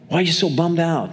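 ordinary delivery

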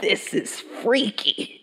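slow, voiceless mock-spooked whisper. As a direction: whisper it slowly, as if creeped out. Spooked reaction to radio preacher.

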